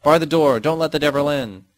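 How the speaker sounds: loud, high panicked cry; mother's shout. Acted. Mother shouting at her daughter at the door, loud and frantic. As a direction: shout it to bar the devil out. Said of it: "heard my mother shout".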